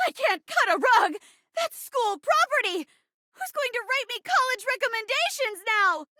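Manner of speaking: high, panicked lamenting; theatrical distress. Acted. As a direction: wail it in hammed-up dismay. Victim laments while forced to dance.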